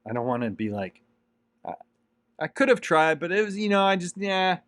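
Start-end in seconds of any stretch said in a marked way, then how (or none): 1.7-4.6 high, halting, flustered make-believe regret; regretful stammer. Pretend. He voices his future regretful self, higher and stammering. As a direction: stumble through the excuse like a man who never tried.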